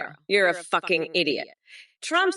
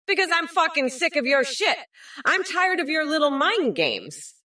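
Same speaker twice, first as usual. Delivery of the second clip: angry rant; 7 semitones higher than usual. Acted. Ranting insults at chat.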